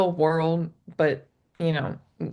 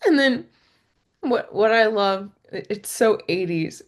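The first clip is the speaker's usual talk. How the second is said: ordinary talk, nothing marked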